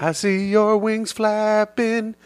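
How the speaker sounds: high, slow angel voice. Pretend. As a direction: speak like a lofty heavenly angel. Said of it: "now do one of the angels".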